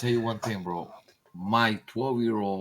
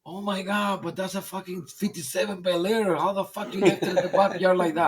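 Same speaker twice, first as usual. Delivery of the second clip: incredulous buyer; 6 semitones higher than usual. Retold or imitated. Disbelief at neglected Bel Air.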